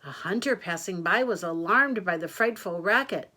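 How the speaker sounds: ordinary delivery, nothing marked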